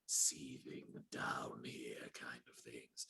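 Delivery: low seething villain hiss; seething villain whisper. Shown as a demonstration. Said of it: "how my character in, uh, Fallen Legion Revenants is".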